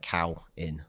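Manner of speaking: "cow in" as two spaced syllables; rhyming pronunciation guide. Shown as a demonstration. Explaining how Irish Samhain sounds, spelling out "cow in". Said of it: "you would pronounce it".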